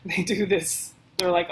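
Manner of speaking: ordinary talk, nothing marked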